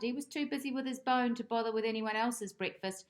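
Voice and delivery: ordinary delivery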